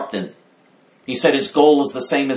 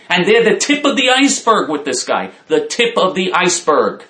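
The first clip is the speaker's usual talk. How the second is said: higher and louder, indignant; rising rant; 6 semitones higher, 4 dB louder than usual. Real, not acted. Ranting to viewers against Trump, pitch climbing. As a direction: build into an incredulous rant.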